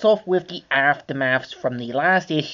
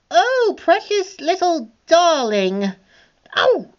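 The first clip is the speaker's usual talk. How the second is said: much higher, slow, fawning coo; Cruella cooing; 14 semitones higher, slower than usual. Acted. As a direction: gush over a cute puppy. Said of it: "and says".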